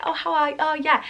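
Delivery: put-on posh accent. Slipping into imitating someone posh around them, posh accent. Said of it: "If I'm around someone posh".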